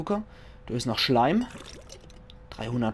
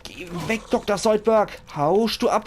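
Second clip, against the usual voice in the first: mild mock panic; 8 semitones higher, 3 dB louder than usual. Keeps the mock panic, milder.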